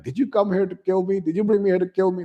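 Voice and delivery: high-pitched, paranoid mock accusation; frustrated. Umbridge accusing the kids in the forest, high and frustrated. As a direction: shoot the voice up into shrill suspicion. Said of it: "she's getting frustrated".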